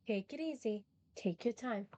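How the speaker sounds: ordinary talk, nothing marked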